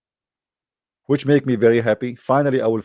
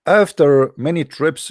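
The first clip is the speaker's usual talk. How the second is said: ordinary talk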